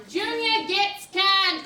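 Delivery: loud, very slow headline; drawn-out, punched headline read; headline announcement. Acted. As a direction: proclaim each word as a threatening headline. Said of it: "I'm seeing another headline".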